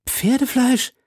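high, incredulous question in disgust. A narrator's reading. Musketeer learns what he is eating, disgusted. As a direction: recoil from the word, pitch shooting up. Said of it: "fragte Aramis mit einer Grimasse des Ekels".

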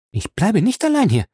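high, loud and determined; firm. Acted. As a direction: insist on it, no arguing. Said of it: "sagte sie bestimmt".

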